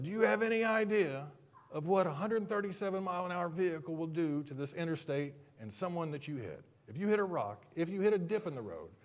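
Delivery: high, loud mock tirade; yelling patrolman. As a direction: holler a scolding like an outraged trooper. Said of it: "he starts yelling at me".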